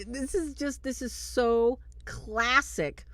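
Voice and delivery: ordinary delivery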